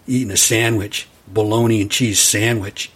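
usual speaking voice

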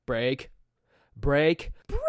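mom yelling brake. Retold or imitated. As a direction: mimic mom snapping brake. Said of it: "she'll be like".

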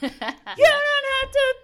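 high put-on voice.

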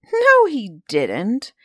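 loud, slow, indignant denial; indignant outcry. A narrator's reading. Townsfolk call out the sergeant's lie, loud slow indignation. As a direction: crowd drags out the protest in outrage. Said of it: "cried out indignantly".

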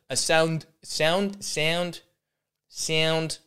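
testing the vowel of "sound" word by word; attempted South African vowel. Shown as a demonstration. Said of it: "the O is, is a big part".

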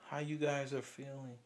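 sympathetic nurse. Retold or imitated. Nurse consoling grieving parents, soft and hushed. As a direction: say it gently, like breaking bad news. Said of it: "Guess what they telling us".

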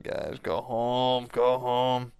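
slow, drawn-out retold plea; exasperated plea. Worker telling drunk family to leave, mildly exasperated. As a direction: stretch out each word, mildly exasperated.

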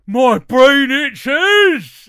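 loud, high, stretched-out British bellow; Mister Gumby. Acted. Imitating a sketch character done at school, slow loud bellow. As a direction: holler each word slowly like a dim oaf. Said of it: "Mister Gumby was like".

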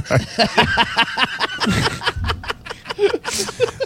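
squeaky giggle fit; high wheezing laugh. Real, not acted. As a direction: laugh until the voice cracks. Cracking up mid-reading.

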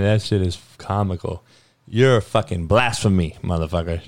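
ordinary delivery

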